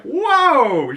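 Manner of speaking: the listener's own jaw-drop; shocked whoa. Retold or imitated. Speaker's own shocked reaction.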